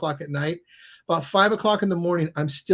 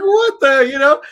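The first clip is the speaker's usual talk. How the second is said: higher and louder, half-yelled disbelief; stunned disbelief; 8 semitones higher, 11 dB louder, slower than usual. Retold or imitated. Recalling the refused referral, a loud, stunned outburst. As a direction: cut off mid-curse in shock.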